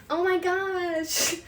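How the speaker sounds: slow, drawn-out mock gasp of disgust. Imitating someone smelling garlic breath, slowly disgusted. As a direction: pull a face and stretch the words out. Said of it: "and you're just like".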